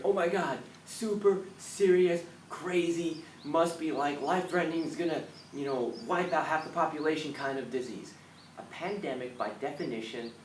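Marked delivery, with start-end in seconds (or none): 0.0-8.1 higher, louder mock alarm. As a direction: ham up the public panic. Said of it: "we hear".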